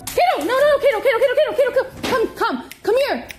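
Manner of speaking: high, loud frantic calling; frantic high calls to keep the dog off broken glass; urgent call to dog. Real, not acted.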